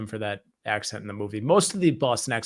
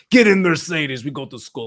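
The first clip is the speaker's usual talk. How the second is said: put-on loud, heavy Russian accent ordering the kids; mock Russian mobster to the kids; 3 semitones higher, 8 dB louder than usual. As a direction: bark it like the mobster. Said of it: "just to say".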